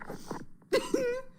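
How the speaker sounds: quick high-pitched laugh; a short burst of giggling; high giggle. Real, not acted. Laughing at ride clip.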